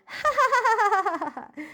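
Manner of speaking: high rattling cackle of laughter; laughing. Acted. Joke teller after the muffin punchline, high laugh. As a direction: crack up at your own joke.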